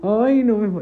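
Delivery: loud, high startled cry; flustered cry. Real, not acted. Nearly falling over, a loud flustered cry. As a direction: blurt it out, rattled.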